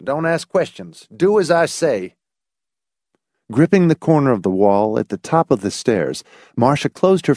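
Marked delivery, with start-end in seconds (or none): 0.0-2.2 higher-pitched, no-argument order; instructing a boy. A narrator's reading. Laws cutting off the boy's questions, instructing. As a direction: order the child in a raised pitch. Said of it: "he instructed the boy".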